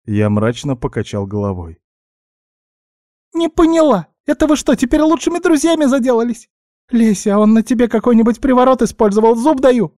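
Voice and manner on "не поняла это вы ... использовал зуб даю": very high, squeaky accusing voice; indignant guinea pig. A narrator's reading. Guinea pig accusing elf of bewitching her friend, indignant squeak. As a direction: squeak with outraged suspicion.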